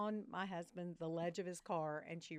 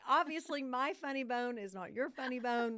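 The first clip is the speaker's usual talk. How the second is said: higher, louder dry quip; mildly hammed-up comeback; wry retort; 6 semitones higher, 6 dB louder than usual. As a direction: land it as a rueful joke. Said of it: "And so I said".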